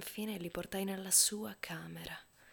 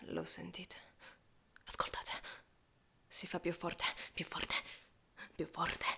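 soft, tense near-whisper; hushed urging; 6 dB quieter than usual. A narrator's reading. Narrator asking the listeners to hear the heart, hushed. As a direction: draw the listener in quietly.

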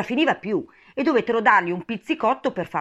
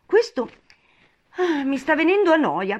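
high-pitched bored yawn on the words; 10 semitones higher, 5 dB louder than usual. A narrator's reading. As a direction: yawn your way through it. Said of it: "con uno sbadiglio". (sbadigliando annoiata)

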